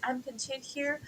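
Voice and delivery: soft, high, put-on voice of fake contentment; quiet, prissy mock of a content teacher; mocking her voice. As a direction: mimic her lying sweetly and softly. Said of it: "saying, like".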